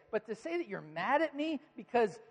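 loud, high-pitched exasperated rant; indignant dad. Retold or imitated. Dad scolding son who blames him, indignant rant.

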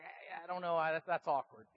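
shut down, stumbling. Retold or imitated. Pastor with no comeback to the prime minister, mock stumped. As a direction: stammer it quietly, as if stuck for an answer. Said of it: "it just shut the pastor down".

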